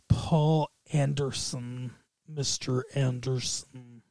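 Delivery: slow, low, hushed villain drawl; Hugo Weaving. Acted. Podcaster toys with the director's name, slow villain voice. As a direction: stretch out the name, low and quiet. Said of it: "I think Hugo Weaving's".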